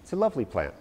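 normal talking voice

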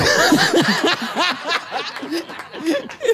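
high laughing burst; big laugh. Real, not acted. (risa aguda y fuerte)

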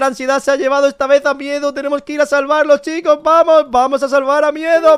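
high, loud call to action; excited, loud urging; urgent rallying call. Pretend. Rallying viewers and Alegría for rescue.

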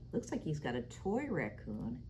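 usual speaking voice

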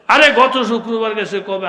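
high, loud burst of comic disbelief; exasperated retort. Acted. Hujur answering a man who skipped prayers, exasperated.

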